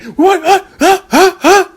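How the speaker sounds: very high, staccato challenges; imitated rage. Enraged woman challenging her ex, high mock rage. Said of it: "she had that rage".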